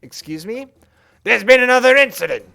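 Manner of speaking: high, loud, snooty potato; obnoxious potato voice. Pretend. Alien potato client scolding the crew for being late, snooty. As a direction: play a rude, pushy spud. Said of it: "Obnoxious Potato gets a distinct voice".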